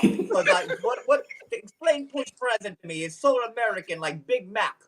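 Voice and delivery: hammed-up Russian-accented bewilderment. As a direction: marvel at America as a baffled Russian. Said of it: "say them in Russian for you".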